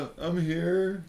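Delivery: sheepish reply. Acted. Adam answers sheepishly from hiding.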